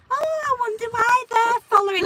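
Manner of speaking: high, drawn-out dim wondering; mock cluelessness. Driver mocking herself for missing the police, high and clueless. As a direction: play your own thick, oblivious thoughts. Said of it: "And I'm so thick, I'm thinking".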